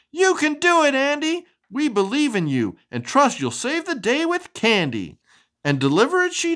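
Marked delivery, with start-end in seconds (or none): 0.1-5.2 shouted cheer. A narrator's reading. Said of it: "clapped and shouted".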